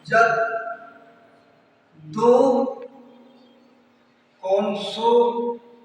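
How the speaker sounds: slow, spaced-out dictation with long gaps. Shown as a demonstration. Teacher dictating the rule to students, slow and spaced. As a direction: spell out the rule word by word for notes.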